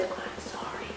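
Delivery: barely-there whisper; imitating a silent sorry across a distance; mouthed apology. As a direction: mouth it with almost no voice. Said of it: "he's mouthed the words".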